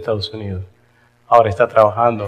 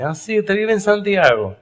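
a higher-pitched lady asking, surprised; curious lady; 9 semitones higher than usual. Retold or imitated. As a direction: play the nosy lady, higher. (voz aguda de señora curiosa)

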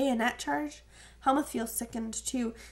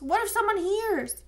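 much higher and louder alarmed cry; frightened cry; 9 semitones higher, 6 dB louder than usual. A narrator's reading. Rudy, panicked at hearing the BBC, crying out. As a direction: blurt it out in sudden panic. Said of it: "he cries".

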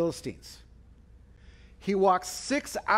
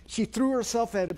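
ordinary talk

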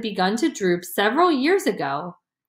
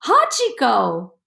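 crying out; 3 semitones higher, slower than usual. A narrator's reading. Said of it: "Yasuo cried".

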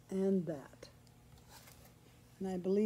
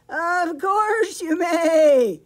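very loud, high, slow bleat; hammed-up storybook sheep; 8 semitones higher, 13 dB louder, much slower than usual. A narrator's reading. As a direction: bleat every word like a sheep. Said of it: "she bleated".

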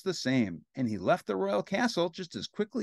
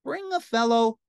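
high, louder princess voice; demanding princess; 9 semitones higher, 5 dB louder than usual. Acted. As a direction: order it like a spoiled princess.

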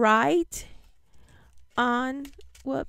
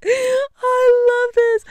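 delighted; 9 semitones higher, 8 dB louder, slower than usual. Real, not acted. Final reaction to the gift.

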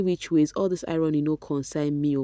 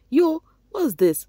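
high, loud, slow outburst; mock outrage; 12 semitones higher, 6 dB louder, slower than usual. Real, not acted. Scolding rival fans, high and loud. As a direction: sound scandalized.

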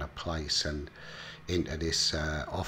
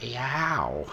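high, drawn-out yelp of pain; mock pained yelp; 7 semitones higher than usual. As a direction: imitate yelping at a needle jab. Acted yelp at needle jab.

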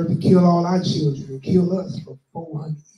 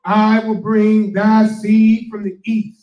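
slow, loud, higher proclamation of scripture; scripture reading; 4 semitones higher, 8 dB louder, slower than usual. Acted. Reading Isaiah to the congregation, slow and loud. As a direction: let each word ring out slowly.

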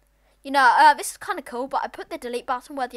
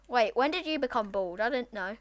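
normal talking voice

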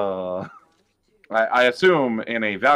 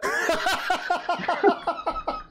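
laughing. Real, not acted. Laughing after dad joke.

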